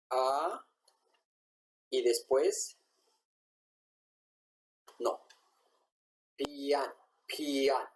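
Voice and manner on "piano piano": "piano" said as "pian", final vowel clipped the Korean way; Korean-style piano. Shown as a demonstration. Teacher modeling the Korean sound of piano, "pian". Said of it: "esto es como lo escuchan ellos".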